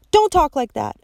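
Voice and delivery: high, loud plea; pleading cry. A narrator's reading. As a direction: beg him to stop. Violet begging him to stop.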